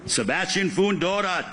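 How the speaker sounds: ordinary talk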